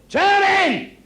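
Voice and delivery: high, loud cry of turn it in; Jesus yelling at the crowd to stop throwing stones; shouted. Retold or imitated. Jesus yelling at stone-throwing women to stop, loud and high. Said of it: "And he said".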